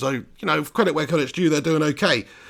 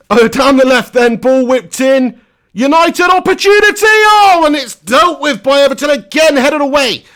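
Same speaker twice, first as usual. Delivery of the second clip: much higher, much louder excited call of the chance; excited commentary shout; 9 semitones higher, 10 dB louder than usual. Real, not acted. Fan streamer calling a United chance, excited shout. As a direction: shout it like the goal is coming.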